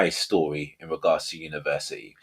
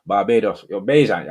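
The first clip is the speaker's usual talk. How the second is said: light attempt at a Bajan accent; 3 semitones higher, 6 dB louder than usual. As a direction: say it in a loose Barbadian accent. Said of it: "I was trying to do a Bajan accent".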